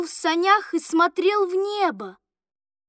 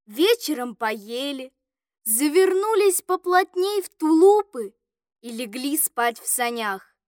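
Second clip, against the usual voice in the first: plain reading voice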